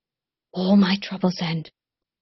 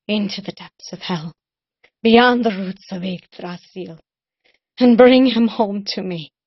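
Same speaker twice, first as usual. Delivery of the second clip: building to a cry; 3 semitones higher, 11 dB louder than usual. Acted. Cullen to Rune naming her price, building to a loud cry. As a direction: build the demand into an anguished cry.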